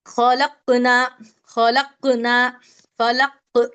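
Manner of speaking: खलकना and फलक with the क bounced as half a pesh; qalqala bounce. Shown as a demonstration. Teacher keeps drilling the qalqala words for students. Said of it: "आधे पेश की जैसा पढ़ रहे हैं".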